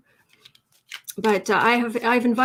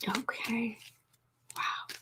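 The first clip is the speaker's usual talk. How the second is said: whispered; 14 dB quieter, much slower than usual. Real, not acted. Hushed reaction to election win.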